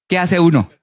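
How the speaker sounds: ordinary talk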